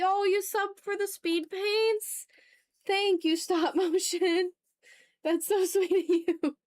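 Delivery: thrilled to hear a fan subbed; delighted thanks. Real, not acted. Streamer thanking viewer Stop Motion, high delighted gushing.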